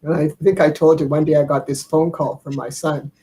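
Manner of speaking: ordinary delivery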